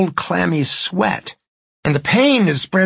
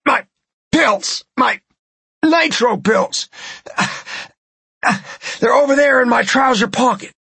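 higher, strained cries of pain; panicked pleading; 10 semitones higher than usual. Acted. Man having heart attack begs woman to call doctor, panicked. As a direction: beg for help as the chest seizes. Desperate plea for pills.

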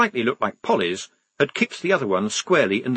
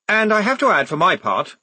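raised, formal address to a crowd; public meeting voice; 8 semitones higher, 4 dB louder than usual. A narrator's reading. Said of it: "in his talking to a meeting voice".

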